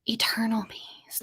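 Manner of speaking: whispered, slow "eternal bees". Pretend. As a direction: breathe it like a secret. Dramatic pitch about special bees.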